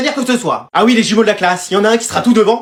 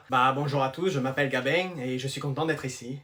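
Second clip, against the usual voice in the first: low, soft southern French twang; southern French accent; 8 semitones lower, 15 dB quieter than usual. Acted. New pupil greeting the class, quiet southern French accent. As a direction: introduce yourself softly in a southern French accent. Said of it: "tu l'entends pas à son accent?".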